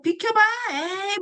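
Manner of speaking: a tiny enzyme grumbling as it pushes through; annoyed amylase. Acted. Amylase shoving past tangled starch, high cartoon grumble. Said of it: "막 이러면서".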